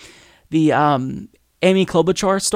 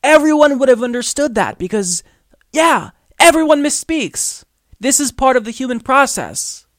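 exasperated rant; 6 semitones higher than usual. Real, not acted. Ranting at Trump.

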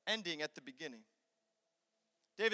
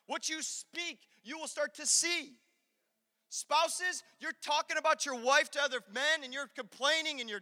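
higher-pitched, insistent sermon delivery; forceful preaching; 7 semitones higher, 4 dB louder than usual. Acted. Preacher warning spouses and ladies about their words, forceful preaching. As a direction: warn them, leaning hard on key words.

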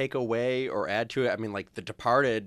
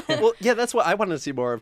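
laughing through the words, louder; 12 semitones higher, 7 dB louder than usual. Real, not acted. Podcast host agreeing with a cohost, laughing. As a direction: still giggling as you agree.

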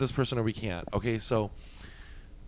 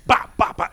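punch sounds; 9 semitones higher, 6 dB louder than usual. Retold or imitated. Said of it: "she went".

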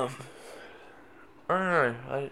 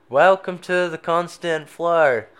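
higher, louder and slow, drawn out; loud slow announcement; 7 semitones higher, 10 dB louder, slower than usual. Pretend. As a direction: boom out the show welcome. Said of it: "No, we're not doing that anymore".

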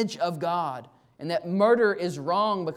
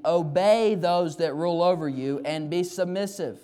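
loud, raised, ringing delivery of verses; preached scripture reading; 3 semitones higher, 5 dB louder than usual. Acted. Pastor reading church-leader verses to his flock, preaching at force. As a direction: proclaim each command to the congregation.

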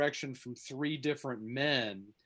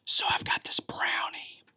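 whispering close to the mic; ASMR whisper. Shown as a demonstration. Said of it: "A S M R demonstration".

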